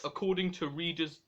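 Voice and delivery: ordinary delivery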